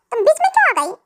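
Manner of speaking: normal talking voice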